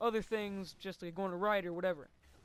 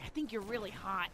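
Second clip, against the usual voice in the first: imitated friend's voice. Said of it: "his voice is".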